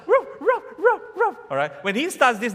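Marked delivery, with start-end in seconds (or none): 0.1-1.4 high-pitched barks, four in a row; short, high "ruff" yelps; imitated dog bark. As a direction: bark like a dog starting up at night. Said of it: "one dog will start".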